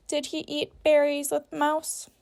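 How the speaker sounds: ordinary talk, nothing marked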